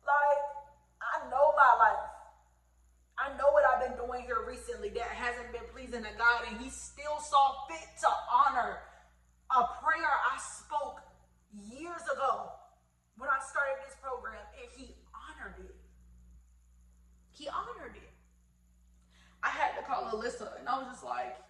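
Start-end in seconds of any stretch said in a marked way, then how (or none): 0.0-15.8 loud, rising praise; fervent testimony. Real, not acted. 17.3-18.1 awed whisper. Real, not acted. Awed repetition.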